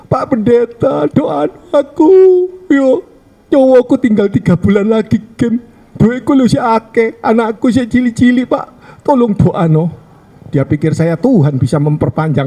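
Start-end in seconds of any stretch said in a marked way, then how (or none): 0.1-10.0 pleading wife. Retold or imitated. Pleading wife begs the pastor.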